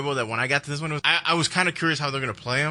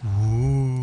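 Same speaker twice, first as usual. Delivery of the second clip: spooky ooh; 6 semitones lower than usual. Retold or imitated. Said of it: "she's like".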